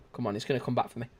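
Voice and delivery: ordinary talk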